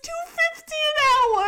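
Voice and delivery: ordinary delivery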